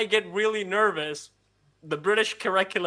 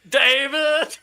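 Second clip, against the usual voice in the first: loud, higher comic exclamation; mock cry; 6 semitones higher, 6 dB louder than usual. Retelling an embassy accent slip, mock dismayed cry. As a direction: call the name out, laughing.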